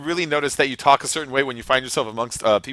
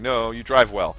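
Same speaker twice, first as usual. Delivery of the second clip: normal talking voice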